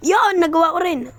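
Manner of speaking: high, loud cry of relief; triumphant shout. Real, not acted. Gamer finally clearing the obstacle, loud and triumphant. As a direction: shout it like you finally won.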